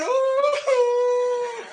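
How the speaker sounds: high, drawn-out wailing sobs; a long, high mock wail; mock crying. Shown as a demonstration. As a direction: wail like a weeping bride. Bride crying at the farewell.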